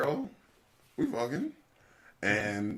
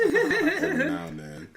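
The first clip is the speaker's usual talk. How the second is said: mock smooth sexy voice; 8 dB louder than usual. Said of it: "like to hear my smooth, sexy voice".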